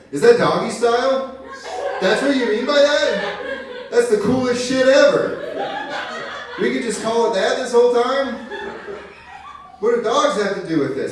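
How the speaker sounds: very high, loud, amazed yelling; excited outburst. Retold or imitated. Comic finally cracking his coworker's slang, high excited shouting. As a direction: shout it like a thrilling revelation. Acting out his thrilled discovery.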